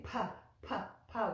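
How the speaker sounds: pa with a strong puff of air; explosive English p. Shown as a demonstration. Teacher showing the English aspirated p. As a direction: push air hard on each pa. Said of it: "explosive with the P".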